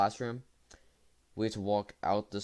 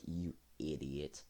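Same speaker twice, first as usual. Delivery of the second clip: a much softer, slow mock mutter of You idiot; muttered insult; 5 semitones lower, 10 dB quieter, slower than usual. Friend who made designs mutters at him, soft and slow. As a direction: mutter it low and slow, half amused. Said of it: "was like".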